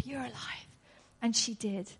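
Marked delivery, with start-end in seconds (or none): 0.0-0.7 soft unvoiced whisper; tender whispered news. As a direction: breathe it to the girl. Gentle whisper to the girl.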